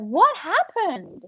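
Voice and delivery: high, drawn-out worried question; acted frantic concern; frantic parent. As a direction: play a panicked parent demanding answers. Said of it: "looking for their kids frantically".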